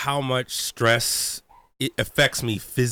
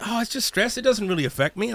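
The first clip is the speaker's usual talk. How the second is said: dismissive past self; 7 semitones higher than usual. Retold or imitated. Imitating his past self.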